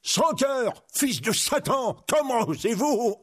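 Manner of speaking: high, indignant little monk; scandalised friar's exclamation; outraged cry. Acted. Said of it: "s'écria frère Tuck".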